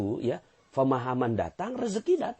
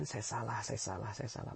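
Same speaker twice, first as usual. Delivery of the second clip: soft repeated admission; 2 semitones lower, 10 dB quieter than usual. Shown as a demonstration. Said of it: "selalu mengucapkan".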